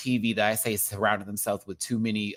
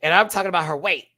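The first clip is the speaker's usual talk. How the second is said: normal talking voice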